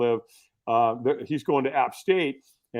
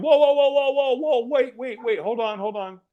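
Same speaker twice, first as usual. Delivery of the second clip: high rapid-fire pleading, easing down; acted-out alarm, words tumbling out then easing; urgent backtracking; 10 semitones higher than usual. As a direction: call him back urgently, starting high and settling. Retold backtracking, stopping a man leaving.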